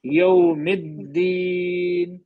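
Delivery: یوم الدین chanted slowly, the د of الدین hard; hard doubled dal. Shown as a demonstration. Teacher models strict dal for student. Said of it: "سختی سے پڑھتے ہیں".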